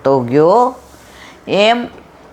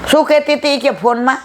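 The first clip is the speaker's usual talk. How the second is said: much higher, sharp annoyed question; irritated question; 10 semitones higher than usual. Retold or imitated. Annoyed caller scolding her on the phone, sharp high question. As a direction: snap the question back, piqued. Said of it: "મારા પર બગડ્યા".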